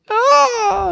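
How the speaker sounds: high-pitched mock wail; loud, high imitation child scream; imitated kids crying out. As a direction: cry out like an upset kid in a cinema. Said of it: "kids crying during it and stuff like".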